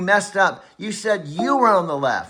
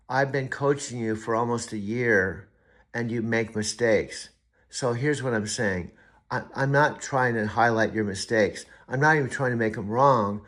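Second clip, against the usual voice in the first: calm down and speak gently; calmer, lower; 6 semitones lower than usual. Real, not acted. Coach speaking privately and gently to his AI.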